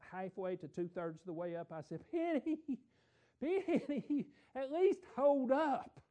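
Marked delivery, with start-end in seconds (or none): 2.1-6.0 high, loud call up the hill; breathless shout to a wife far ahead. Retold or imitated. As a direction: holler after her across the lot.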